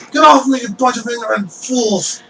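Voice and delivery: high-pitched indignant outburst; shrill-edged fury, pitched well up; angry protest. Acted. Captured necromancer to the crowd chasing him, angry protest.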